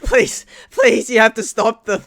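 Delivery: loud, high, desperate cries; frantic pleading. Acted. Game master as terrified woman grabbing a rescuer, frantic. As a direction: beg at the top of your voice. Violently shaking player, begging.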